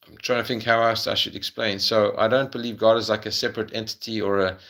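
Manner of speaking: ordinary talk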